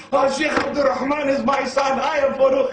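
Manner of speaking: loud, high, overjoyed father's cry; excited retold scream. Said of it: "He comes out the house screaming".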